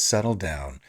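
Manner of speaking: low, quiet deadpan; deadpan settle down. Retold or imitated. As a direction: drop it low and flat. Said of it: "one character constantly goes".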